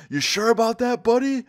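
high-pitched, loud mock challenge; taunting threat. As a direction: imitate a friend daring him, voice shooting up.

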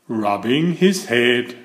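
plain reading voice

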